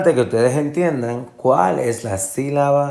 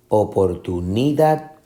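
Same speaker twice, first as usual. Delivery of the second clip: oportunidad with NI raised; misplaced fourth stress. Shown as a demonstration. Teacher asking which is right, oportu-NI-dad. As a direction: lean on NI in oportunidad. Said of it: "la fuerza de la pronunciación".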